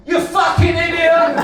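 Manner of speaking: high, very loud outburst of road rage; Granddad's angry shout. Retold or imitated. Child imitates Granddad shouting when he's driving, angry shout. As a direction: explode at another driver, loud and high. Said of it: "What's Granddad say?".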